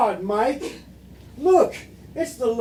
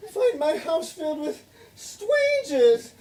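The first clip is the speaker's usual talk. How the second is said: ordinary talk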